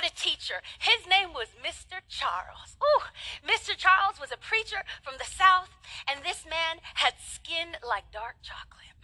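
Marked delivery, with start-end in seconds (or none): 6.1-9.0 hushed whisper. Real, not acted. Preacher to the congregation, hushed whisper. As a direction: whisper it like a secret. Whispered aside about the teacher.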